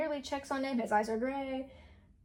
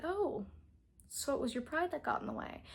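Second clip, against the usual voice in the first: higher, softer mock-innocent 'oh'; calm, condescending dad; 5 semitones higher, 5 dB quieter than usual. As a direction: imitate a level-headed narcissist, quietly needling. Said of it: "he's super calm and level-headed".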